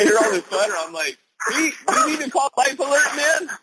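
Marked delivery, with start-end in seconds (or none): 1.5-3.5 panicked cry for help. Retold or imitated. Panicked call for help, retold.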